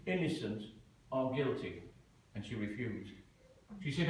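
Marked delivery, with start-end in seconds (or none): none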